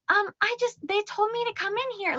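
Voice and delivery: put-on a little girl explains herself in a high voice; little kid voice. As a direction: speak like a shy little girl. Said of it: "in her, like, little kid voice".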